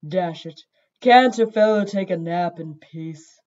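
slow grumbling cat voice; low sleepy rumble. A narrator's reading. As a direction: grumble it, disturbed from a nap. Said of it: "a low, sleepy cat voice".

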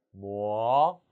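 뭐 starting low and climbing; rising tone. Shown as a demonstration. Teacher telling learners to raise it, rising 뭐. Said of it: "올리세요".

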